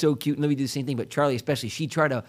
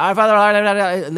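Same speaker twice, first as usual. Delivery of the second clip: rushed mumbled prayer; 5 semitones higher, 6 dB louder than usual. Retold or imitated. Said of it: "Libby, God can't hear that".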